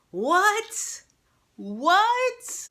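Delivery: very high, drawn-out rising question; shocked disbelief. Retold or imitated. Viewer reacting to Tiedemann's fall, high-pitched disbelief. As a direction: let it shoot up, hammed-up shock.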